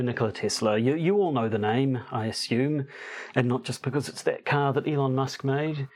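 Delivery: ordinary delivery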